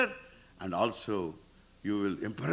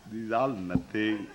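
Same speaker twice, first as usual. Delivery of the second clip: usual speaking voice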